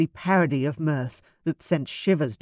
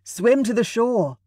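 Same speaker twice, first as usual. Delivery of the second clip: raised, emotional call; tight-throated urgent plea; 6 semitones higher, 3 dB louder than usual. A narrator's reading. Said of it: "his throat tight with emotion".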